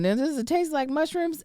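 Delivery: high-pitched questioning voice; doubtful listener. Acted. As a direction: voice a listener wondering aloud. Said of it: "most of you probably thinking".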